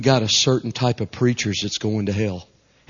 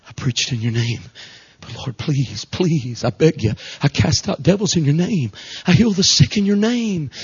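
pleading; 3 semitones higher than usual. Acted. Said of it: "Here's the translation".

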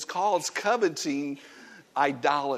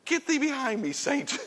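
rebuking Satan; 8 semitones higher, 3 dB quieter than usual. Retold or imitated. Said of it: "I thought".

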